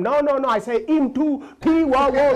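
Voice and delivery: put-on high, loud insisting Indian-accented voice; mock Indian teacher. Indian teacher insisting to the boy, loud high accent. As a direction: insist loudly with an Indian lilt.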